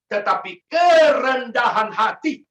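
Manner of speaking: kerendahan hati shouted out slow and high; emphatic stress. Acted. Preacher to congregation, loud, slow kerendahan hati.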